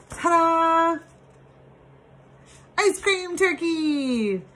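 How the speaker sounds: high, thrilled ta-da reveal; gushing makeover reveal. Acted. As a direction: unveil it like a proud surprise. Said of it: "are you ready for the reveal".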